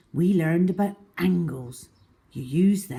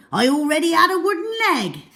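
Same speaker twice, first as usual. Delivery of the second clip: high, loud, quick pirate brag; boastful pirate captain; 11 semitones higher, 6 dB louder, faster than usual. A narrator's reading. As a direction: brag it like an old sea dog.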